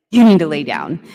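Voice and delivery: loud, forceful command, quoting him; retold aggressive order. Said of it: "kind of aggressively by the arm".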